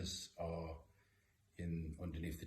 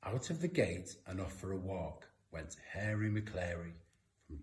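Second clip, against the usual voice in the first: lilting, louder rhyming storytelling that builds with each refrain; picture book read-aloud; 4 semitones higher, 8 dB louder than usual. A narrator's reading. Parent reading a rhyming dog story to kids, lilting and rhythmic. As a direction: read it like a bedtime picture book for little ones.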